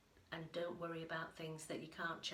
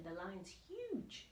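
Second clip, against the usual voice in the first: usual speaking voice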